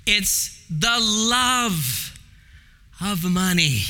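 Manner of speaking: love said very slowly, then a pause; stress on love. Shown as a demonstration. Preacher correcting the kids, slow stress on love. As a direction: linger on the word love. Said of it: "I didn't say money brings ruin".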